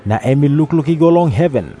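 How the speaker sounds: usual speaking voice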